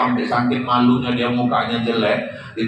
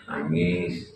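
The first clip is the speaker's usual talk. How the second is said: much lower and softer sob; mock soft sob; 9 semitones lower, 10 dB quieter than usual. Widow sobbing over her husband's scooter, softly. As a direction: whimper it.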